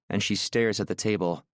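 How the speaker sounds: ordinary delivery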